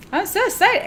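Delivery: high-pitched excited burst; giddy, high little outburst. Real, not acted. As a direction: let excitement lift the pitch way up. Excited outburst about the coming retreat.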